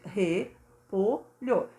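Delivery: "repolho" very slowly as re... po... lho; syllable by syllable. Shown as a demonstration. Teacher showing pupils how to count syllables, "repolho" said syllabically. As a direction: split "repolho" into three drawn-out beats. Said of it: "como eu falo ele silabicamente".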